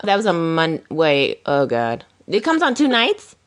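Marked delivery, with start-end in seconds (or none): 2.3-3.4 surprised question. Real, not acted. Surprised at two nights.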